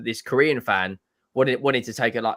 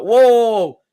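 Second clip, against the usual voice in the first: loud, high startled cry then brisk change of subject; mock alarmed outburst; startled whoa; 6 semitones higher, 9 dB louder, slower than usual. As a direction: jump in alarm, then hurry past it. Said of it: "he was like".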